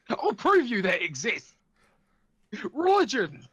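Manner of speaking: loud, high mock protest; mock-defiant outburst. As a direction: proclaim it like an indignant zealot, playfully. Mock zealot defending their religion.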